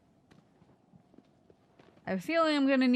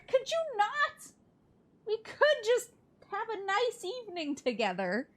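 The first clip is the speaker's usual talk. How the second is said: pleading voice; 12 semitones higher than usual. Pretend. Voicing the mad creature.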